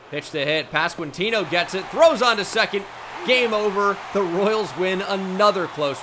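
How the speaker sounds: rising to a loud, high "Game over"; excited game-ending call. Real, not acted. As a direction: call the final out like a broadcaster. Game-ending double play call.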